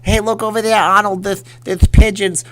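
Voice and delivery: loud, high lisping boxer; lisping boxer impression, loud; Mike Tyson. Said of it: "He'd be like".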